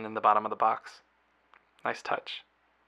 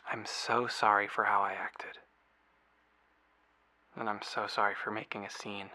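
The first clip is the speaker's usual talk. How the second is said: remorseful; 5 dB quieter than usual. Acted. Apologizing after making a scene.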